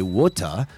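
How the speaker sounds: "water" as waw-tuh, clear t and no r; dropped final R. Shown as a demonstration. Teacher comparing ways to say water, non-rhotic ending. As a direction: end "water" on an open uh. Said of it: "if you pronounce it that way".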